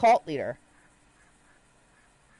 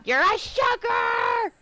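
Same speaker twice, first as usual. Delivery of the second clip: mock yell; 12 semitones higher, much slower than usual. Mock accusation reading cult list.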